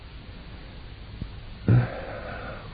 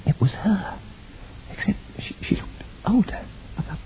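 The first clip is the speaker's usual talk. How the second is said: hushed stunned whisper; 11 semitones higher, 10 dB quieter than usual. Acted. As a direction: breathe it out in shock.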